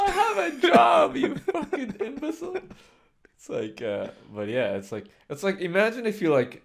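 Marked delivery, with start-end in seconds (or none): 0.0-2.6 mock indignant outburst. Host defending himself from co-host's jab, mock fury. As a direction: squawk it in fake outrage.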